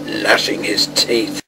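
plain reading voice

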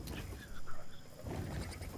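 exasperated whisper. Real, not acted. Shocked at the price.

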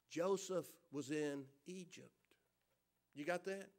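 slow, hushed emphasis. Acted. Preacher stressing where Joseph was, hushed and slow. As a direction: drop low and soft, drawn out. Repeated key line for emphasis.

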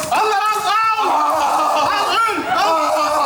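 high, frantic repeated cries; exasperated outburst. Acted. Man driven mad by his tent partner, frantic angry outburst. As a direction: lose your temper in short, high bursts. Acted fit of rage at friend.

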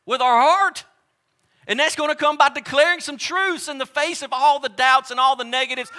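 high, loud preacher's climb; preaching at full force. Acted. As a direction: build the sermon to a shout.

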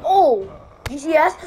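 loud startled cry; surprised shout. Real, not acted. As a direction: react loudly in surprise. Surprised by the move.

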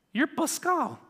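high, startled half-question; suddenly recognizing an old face; surprised recognition. Retold or imitated. Deaf man's surprised recognition.

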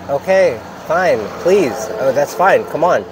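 fake-agreeable, fed up; exasperated. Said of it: "I said, in my mind".

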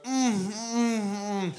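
drawn-out approving hum with mouth full; mouth-full humming. Acted. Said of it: "didn't want to talk with my mouth full".

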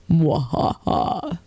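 drawn-out sinister laugh, slow; slow evil villain cackle. Pretend. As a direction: cackle like an old radio villain. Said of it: "every time I think of Graham or Hannibal, it's like".

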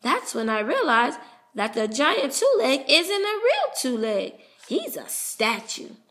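plain reading voice

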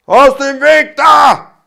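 slow, very loud and high bellowing robber; wild shout. A narrator's reading. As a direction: roar to clear the way. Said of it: "mit einem wilden Schrei".

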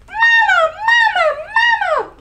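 put-on very high, loud baby-bird calls; squeaky gosling cries. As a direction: squeal like a newly hatched gosling. Newborn goslings call bear.

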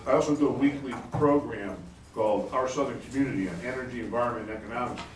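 ordinary talk, nothing marked

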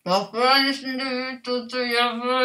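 garbled through a full mouth; cheeks stuffed full. Real, not acted. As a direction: mouth crammed, stifling laughter. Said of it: "Kendine bakıp gülme krizine giriyor".

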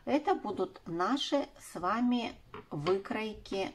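usual speaking voice

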